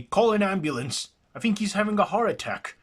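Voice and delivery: high, loud and fast, calling for an ambulance; panicked bystander shouting for help; urgent call for help. Acted. Bystander yelling to the crowd, frantic and loud.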